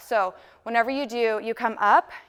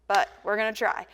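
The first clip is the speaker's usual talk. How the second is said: ordinary talk, nothing marked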